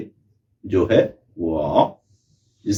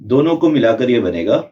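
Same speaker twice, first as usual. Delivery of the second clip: usual speaking voice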